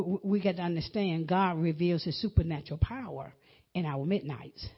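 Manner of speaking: ordinary talk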